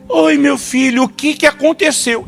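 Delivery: high, sweet mock mother's coo; doting mother. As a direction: coo like a fussing mom. Mother soothing the fallen son.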